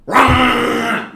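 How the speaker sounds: loud high Wookiee roar; sudden howling Wookiee yell; Chewbacca roar. Acted. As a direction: roar like Chewbacca, full force. Chewbacca screams at him.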